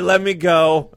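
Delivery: usual speaking voice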